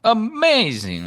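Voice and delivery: high drawn-out amazing; like James. Retold or imitated. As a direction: stretch amazing up high and slow. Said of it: "I'll say it like James".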